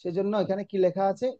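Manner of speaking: ordinary talk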